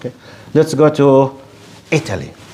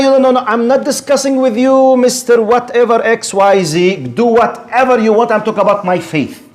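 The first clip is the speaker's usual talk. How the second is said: emphatic brush-off; 6 semitones higher than usual. Acted. Brushing off an imagined challenger.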